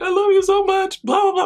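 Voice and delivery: high, loud mock-crying voice; imitated tearful friend. Best friend calling after the surprise, imitated high tearful gushing. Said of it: "My best friend calls me and cries".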